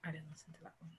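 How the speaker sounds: soft unvoiced whisper; whispered admission. Real, not acted. Guest quietly admitting to the host she skipped it, whispered. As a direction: breathe it out, a little sheepish.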